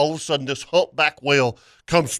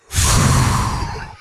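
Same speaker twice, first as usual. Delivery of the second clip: imitated whale blow; 4 semitones lower than usual. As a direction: blow air out like a whale spouting behind you. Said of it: "I hear this".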